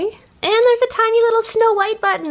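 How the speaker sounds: high, sweet cooing voice; cutesy high voice. Real, not acted. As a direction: coo over something tiny. Cooing over tiny button.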